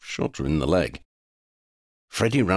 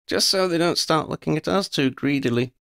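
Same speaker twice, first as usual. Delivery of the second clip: mischievous; 11 semitones higher than usual. A narrator's reading. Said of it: "with a mischievous smirk".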